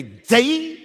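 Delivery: a sharp little warning in your head; scolding inner voice. Retold or imitated. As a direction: catch the mistake with a quick reproach. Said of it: "you hear my voice say".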